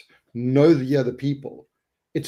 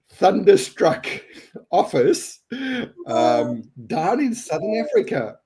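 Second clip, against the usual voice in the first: holding back laughter; 5 semitones higher than usual. Real, not acted. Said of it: "I can't keep a straight face".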